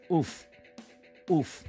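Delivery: normal talking voice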